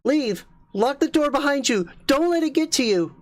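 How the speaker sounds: very high, desperate warning cries; frantic yell. Acted. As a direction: scream at him to get out. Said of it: "Rachel yelled".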